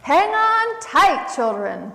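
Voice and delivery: high, loud, drawn-out warning yell. A narrator's reading. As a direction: yell an urgent warning to the children, stretching the words. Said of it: "Possum yelled".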